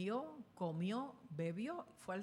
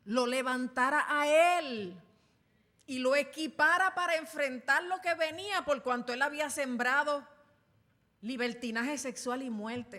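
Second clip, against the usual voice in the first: full-force preaching; 6 semitones higher, 10 dB louder than usual. Acted. Preacher driving home his point to the congregation, full force. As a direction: preach it out with all your strength.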